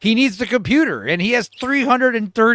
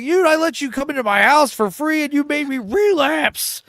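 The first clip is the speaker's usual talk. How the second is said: mock furious accusation; 9 semitones higher than usual. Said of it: "Travis freaks out on Michael saying".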